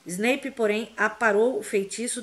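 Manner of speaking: ordinary delivery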